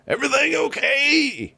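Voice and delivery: high, sing-song call from upstairs; high character voice. Acted. Voice from upstairs calling down to the players, high and sing-song. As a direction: call down sweetly to check.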